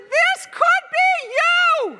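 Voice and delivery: very high, loud, slow yell; cheeky shouted taunt from the rope; shouted back. Retold or imitated. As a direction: holler a warning across. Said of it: "And I said".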